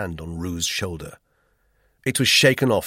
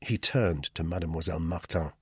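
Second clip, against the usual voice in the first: ordinary delivery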